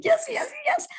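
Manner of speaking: high, joyful yeses; excited yes. Shown as a demonstration. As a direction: celebrate like it's already done. Demonstrating her feeling of success.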